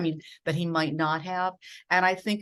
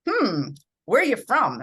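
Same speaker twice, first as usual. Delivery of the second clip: higher, louder retold question; Don Pardo; 5 semitones higher, 7 dB louder than usual. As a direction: lightly act out sizing up a newcomer. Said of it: "looks me up and down and he says".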